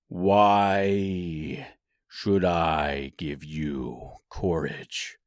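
very slow, low wizard voice; deep, drawn-out challenge; demanding Oz. A narrator's reading. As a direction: demand it low, stretching each word. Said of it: "demanded Oz".

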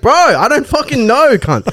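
high, frantic retort; exasperated reply. Retold or imitated. Lost passenger answering the pestering driver, exasperated.

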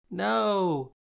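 a loud, high moan. A narrator's reading. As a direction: moan it out, miserable. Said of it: "I moaned".

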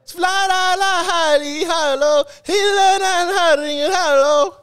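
high, slow sing-song mock Arabic syllables; fake Arabic gibberish. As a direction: chant "fla la la ha li ha lo" in a high drawn-out pseudo-Arabic lilt. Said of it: "it's not a real language".

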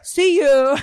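high-pitched mock goodbye; huffy daughter. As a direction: imitate the daughter signing off high. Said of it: "she's like".

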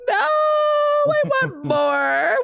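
very high, drawn-out mock-crying wail. Fan reliving when she started Diamonds, hammed-up high wail. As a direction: whine it way up high, stretching every word.

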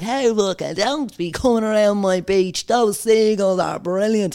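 hammed-up telling-off of an outsider; mock indignant local. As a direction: draw out a pretend territorial warning. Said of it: "I wouldn't be like".

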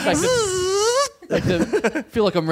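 high, laughing through words. Real, not acted. Laughing while talking.

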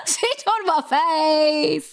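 high, drawn-out jokey parody; hammed-up high repeat for laughs; mocking echo. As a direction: stretch it out slowly in a high voice. Mockingly echoes friend's rude line.

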